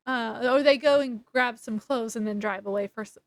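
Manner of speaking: ordinary talk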